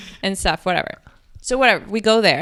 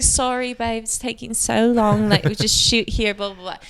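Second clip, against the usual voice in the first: put-on British accent. British friend apologising for the long wait, British accent. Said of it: "She's British".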